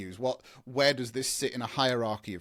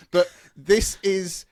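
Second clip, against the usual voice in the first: hammed-up French accent, high and slow; 7 semitones higher, 10 dB louder, slower than usual. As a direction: play a Frenchman in a café, drawn out and lifted. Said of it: "I'm not gonna stop the French accent".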